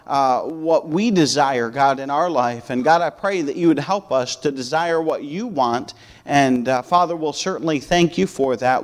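earnest pastoral prayer. Real, not acted. Leads a congregational prayer.

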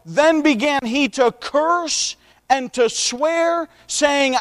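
slow, drawn-out Bible reading; scripture reading. A narrator's reading. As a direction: read the verse deliberately, stretching the quoted words. Reads scripture aloud.

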